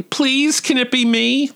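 high-pitched playful plea; mock begging. Student begging to be the next bad boy, high mock pleading. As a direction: beg eagerly, way up high.

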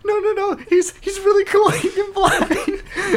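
high, loud protest breaking into laughter; mock pleading. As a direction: plead like an overexcited fan, hammed up. Plays excited fan defending himself.

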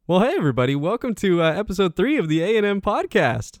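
bright, high show-opening voice; podcast host intro. Acted. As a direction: welcome listeners like a host.

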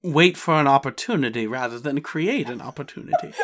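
a hint of a Southern merc, laughing through it; a touch of an easygoing Southern voice; Southern accent. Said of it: "I know what this voice is".

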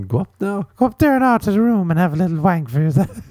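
high Irish mammy; mock mom voice. Mom sending her son to his room, high mock-mammy voice. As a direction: send the son upstairs in a high comic voice. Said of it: "If you were a mom and you told your son".

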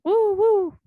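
high, loud celebratory whoop; excited whoop. Real, not acted. Host cheering on being called the next best thing, whooping.

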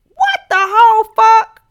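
loud, high exclamation of disbelief; what the whole fuck, loud and high. Real, not acted. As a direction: blurt it out in shock. Host ranting at Louise's choices.

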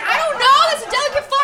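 high, loud nervous fretting. Retold or imitated. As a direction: fear your own strength. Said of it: "I was like".

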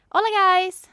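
high, loud, bubbly greeting; happy and fake. Shown as a demonstration. Friend demonstrating her signature greeting, overly glad. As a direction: hit it like a cheery show host. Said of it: "jag säger väldigt glad och fake".

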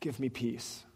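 hushed, pleading prayer. Shown as a demonstration. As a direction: softly echo the prayer. Prayer echoed softly.

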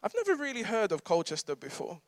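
a hint of the auntie, no accent; auntie's words. Auntie speaking over the student, lightly voiced. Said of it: "I won't do an accent".

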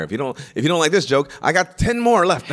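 loud, high, pushy club-comic patter; cocky rapid-fire comic. Retold or imitated. Mimicking the young comic's rapid-fire set, hammed-up. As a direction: sell it like a desperate hack.

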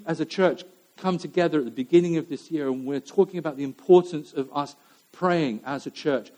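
ordinary talk

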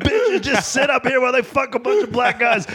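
high-pitched ranting, acted out; hammed-up scream without much added volume; retold crazed screaming. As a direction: rant like you've lost it, voice way up. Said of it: "I'm just screaming, like, in crazy mode".

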